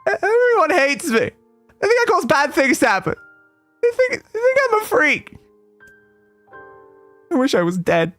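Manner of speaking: put-on tearful girl. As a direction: let it break with tears. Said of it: "her tears ... began to fall".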